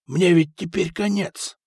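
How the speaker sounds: stunned despair. Acted. Foka horrified at losing everything.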